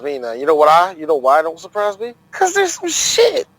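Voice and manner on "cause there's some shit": laughing. Real, not acted. Bursts out laughing at Dolphins.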